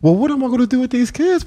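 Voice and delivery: high, fast, flustered; overwhelmed by thirty girls, high and fast. Retold or imitated. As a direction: blurt it out high and quick. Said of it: "I'm like".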